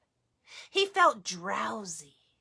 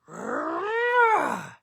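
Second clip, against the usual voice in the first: rolling growl; bear growl; 8 semitones higher, 5 dB louder than usual. Acted. Old Bear answering Baba Brena, growling. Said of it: "growled Old Bear".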